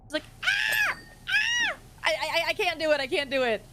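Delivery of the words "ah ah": very high short mock scream; imitating Matara's scream. Showing how the friend screams, very high shrieks. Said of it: "Matara has a very distinct scream".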